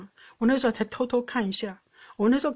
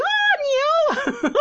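much higher delighted squeal at a cow; excited cry; 6 dB louder than usual. Retold or imitated. Easily delighted wife spots a cow on the walk, squealing. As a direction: call out the animal like a thrilled child. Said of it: "我是一个很容易开心的人".

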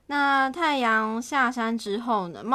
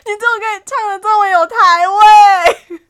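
laughing protest; 9 semitones higher, 12 dB louder than usual. Real, not acted.

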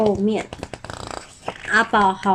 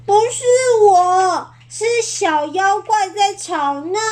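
委屈辩解; 9 semitones higher than usual. Acted. 阿宝委屈辩解.

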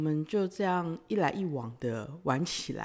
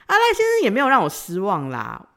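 normal talking voice

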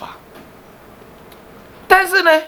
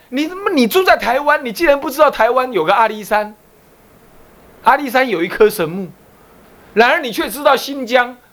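raised-pitch imitation of an adult scolding; mock scolding; 6 semitones higher than usual. 大人骂学生不知道阿里山，轻度模仿责骂. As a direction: play the scold, higher, mildly indignant. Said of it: "骂说".

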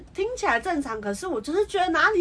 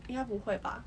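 whispered reply; 4 semitones lower, 12 dB quieter than usual. Real, not acted. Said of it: "你讲气音".

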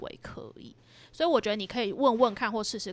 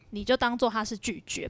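ordinary talk

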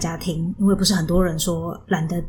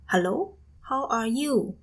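imitating mom's greeting; 4 semitones higher, 3 dB quieter, much slower than usual. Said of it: "她最近看到我都会说".

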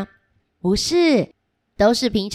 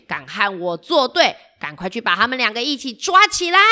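angry villain; 5 semitones higher than usual. Acted. Villain finds prison empty.

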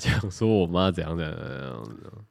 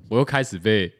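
ordinary delivery

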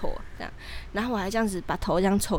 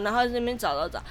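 ordinary talk, nothing marked

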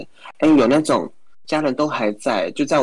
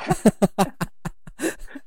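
breathy high-pitched giggle; 11 semitones higher, 3 dB quieter than usual. Real, not acted. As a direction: giggle softly. Laughing at the joke.

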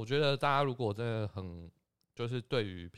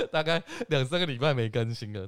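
laughing, louder; 4 semitones higher, 6 dB louder than usual. Real, not acted. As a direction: laugh sheepishly while admitting it. Laughing self-mockery. (笑着自嘲)

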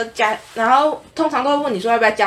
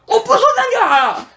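much higher, louder imitated scolding; mock angry yell; 14 semitones higher, 5 dB louder than usual. Driver yelling at the attendant, mock angry shout. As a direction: snap it like an irritated driver. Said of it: "突然很大声骂我".

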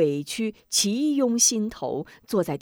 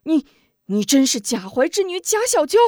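sharp, raised, shocked questioning; 厉声质问; stern demand; 7 semitones higher, 7 dB louder than usual. Acted. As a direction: demand the truth harshly. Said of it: "厉声问道".

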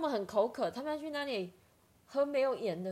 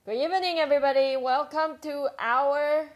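announcer voice; 5 semitones higher, 9 dB louder than usual. Acted. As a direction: open the show like a host. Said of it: "Awesome joke time".

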